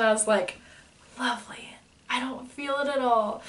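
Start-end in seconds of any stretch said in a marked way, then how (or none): 1.2-3.4 blissful. Retold or imitated. Retold blissful reply.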